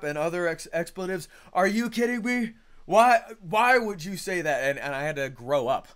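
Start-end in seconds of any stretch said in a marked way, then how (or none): 1.5-4.6 louder, higher mock outrage; hammed-up indignant protest; a put-on angry rant at a critic; mock hostile indignation. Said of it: "And then I'd get hostile".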